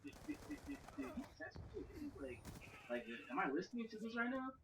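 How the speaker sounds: high, soft stammering disbelief; stunned girl, stumbling in high pitch; bewildered. Retold or imitated.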